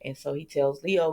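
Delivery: ordinary delivery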